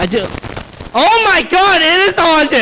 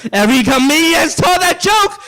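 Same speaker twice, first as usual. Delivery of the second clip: ordinary talk, nothing marked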